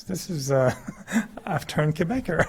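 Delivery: laughing as he says it; amused realization. Real, not acted. Discovering his own Quebec habit, laughing.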